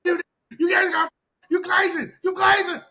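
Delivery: high, loud, whining mock accusation; mocking whiny fan. Mocking fans shrieking in his comments.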